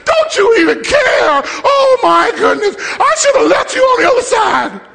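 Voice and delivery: very high-pitched hammed-up hysterical yelling; yelling at him. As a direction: wail and holler like a panicked, outraged disciple. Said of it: "we're yelling at him".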